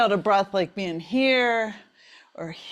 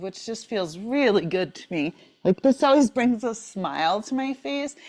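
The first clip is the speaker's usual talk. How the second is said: ordinary talk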